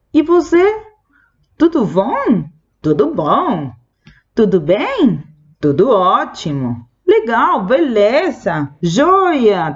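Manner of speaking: casual Brazilian greetings, pitch leaping up; lively Portuguese replies. Shown as a demonstration. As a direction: bounce the replies like a friendly chat. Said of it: "Después tenemos".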